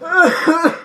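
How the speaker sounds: high, put-on coughing; exaggerated sickly cough; fake cough. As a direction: cough as if feigning illness. Feigning illness to skip school.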